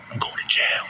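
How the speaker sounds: voiceless whisper. Acted. Joking to viewers he's going to jail, whispered. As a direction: whisper it like a confession.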